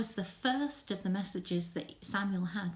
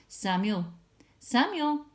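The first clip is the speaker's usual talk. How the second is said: second call louder and higher; 3 semitones higher, 5 dB louder, slower than usual. Acted. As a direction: call out, rising. God's voice calls again.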